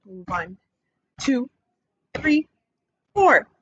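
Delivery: ordinary talk, nothing marked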